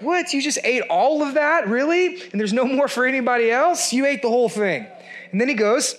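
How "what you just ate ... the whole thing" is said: high, shocked "what, really?"; incredulous disbelief. Retold or imitated. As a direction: gasp at the greedy eater in outrage. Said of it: "You're like".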